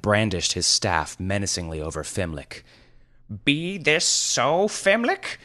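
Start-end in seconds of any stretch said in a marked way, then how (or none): 3.5-5.4 high, slow mock-archaic wizard voice; demanding wizard. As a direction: demand it with theatrical gravity. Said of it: "he demanded".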